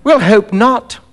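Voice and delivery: a touch of dismissive impatience; Macfarlane, brisk and dismissive. Acted. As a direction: shrug it off with cool advice.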